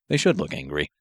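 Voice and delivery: ordinary delivery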